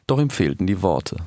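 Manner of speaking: ordinary delivery, nothing marked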